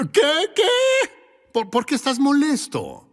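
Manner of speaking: high, incredulous double what; mock surprise. Preacher mocking an envious listener with incredulous surprise. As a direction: play the baffled envier, pitch jumping up.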